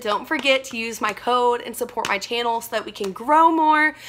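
ordinary delivery

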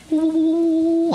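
high, sustained eerie "ooh"; mock mystical ooh. Crystals drawing psychic energy, mock mystical ooh.

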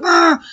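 high, loud frustrated "Arr!" at the speeding driver; frustrated growl. Retold or imitated. Narrator growling at a honking driver, frustrated. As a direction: let out a short exasperated growl. Angry cry as guy speeds off.

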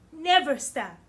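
ordinary delivery

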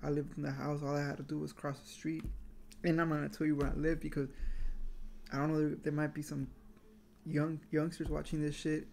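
chewing between words; talking around a spoon; mouth full. Real, not acted. Seen 0: Spoon of cereal held at mouth, chewing throughout the labelled words.

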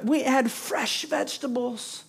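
lightly mock the longing for Egypt; preacher teasing the people's rosy memory of bondage; mock nostalgia. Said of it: "they're gonna".

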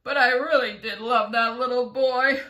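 loud, acted tearful lament; sobbed. A narrator's reading. Earthworm confesses love for the boy, mock sobbing. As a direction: blubber it out in a storyteller's sob. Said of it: "the earthworm sobbed".

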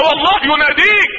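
normal talking voice

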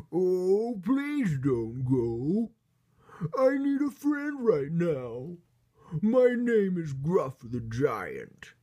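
loud, slow, high wailing giant; big hammed-up giant voice, swooping in pitch; pleading giant. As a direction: drag out the plea like an upset storybook giant. Crying giant begs bunny to stay.

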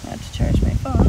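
whispered under his breath; whispered aside. Real, not acted. To himself mid-project, a whispered reminder. Muttering aside while working.

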